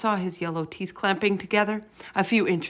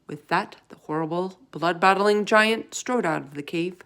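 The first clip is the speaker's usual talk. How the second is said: usual speaking voice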